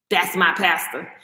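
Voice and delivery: low, slow, dismissive echo; mocking churchgoer. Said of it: "they're like".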